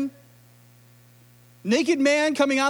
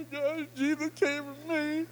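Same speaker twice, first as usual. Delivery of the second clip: tearful prayer; 3 semitones higher, 8 dB quieter, slower than usual. Retold or imitated. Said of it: "I'm like".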